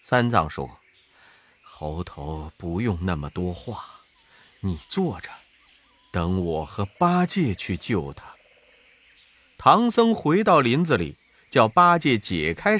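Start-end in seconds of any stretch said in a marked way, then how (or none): 1.7-8.2 put-on low, soft voice of an old monk; gentle monk. A narrator's reading. Master asks his disciple for food, low and soft. Tang Seng voiced in story.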